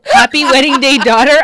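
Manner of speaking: yelling the card back at him; mock shouting the card. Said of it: "I said".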